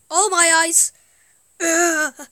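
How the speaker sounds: normal talking voice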